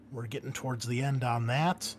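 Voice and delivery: ordinary delivery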